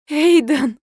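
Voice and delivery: high, loud tearful cry; wife crying out her husband's name. A narrator's reading. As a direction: cry out his name in tears. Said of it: "заплакала я".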